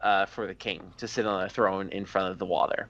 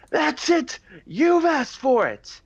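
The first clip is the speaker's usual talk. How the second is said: high, loud, slowed threat; angry shout; 4 dB louder, slower than usual. Acted. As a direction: snap and threaten. Angry king losing temper.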